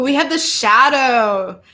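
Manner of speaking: usual speaking voice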